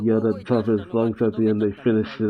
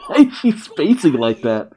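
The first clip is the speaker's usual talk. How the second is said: laughing; 11 semitones higher, 5 dB louder than usual. Real, not acted. Laughing at the character.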